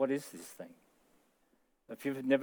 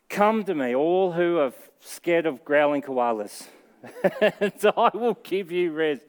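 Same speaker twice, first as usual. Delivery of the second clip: laughing quote; 5 semitones higher, 7 dB louder than usual. Acted. Jokey Jesus quote, laughing.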